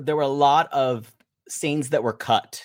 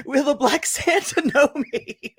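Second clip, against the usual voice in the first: mock horror; 9 semitones higher than usual. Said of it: "look of horror on her face".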